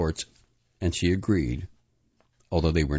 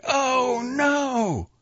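very high, drawn-out scream; 3 dB louder than usual. A narrator's reading. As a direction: scream it in alarm, pitch way up. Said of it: "and then screamed".

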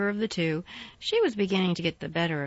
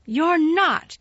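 high, loud blunt denial; unsympathetic contradiction; 9 semitones higher, 8 dB louder than usual. A narrator's reading. Mary dismissing Colin's illness, loud and blunt. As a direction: cut him off flatly. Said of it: "contradicted Mary unsympathetically".

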